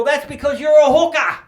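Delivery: high loud yell across the shop. Retold or imitated. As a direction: yell it out to the girl. Guy yelling out to a girl.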